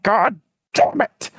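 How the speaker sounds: hammed-up exasperation; frustrated curse. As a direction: drag out the curse in comic frustration. North Korea losing, curses.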